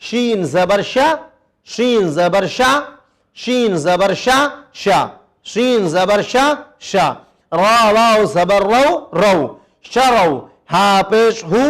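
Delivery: slow, syllable-by-syllable spelling; slow spelling drill. Shown as a demonstration. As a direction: spell it out slowly for the children. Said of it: "اب ہم اس کو ہجے کر کے پڑھتے ہیں".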